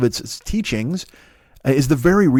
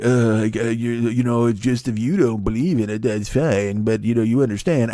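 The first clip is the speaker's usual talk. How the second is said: complaining letter writer; 2 semitones lower than usual. Retold or imitated. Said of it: "there's always one person who writes me".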